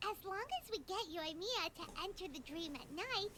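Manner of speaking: usual speaking voice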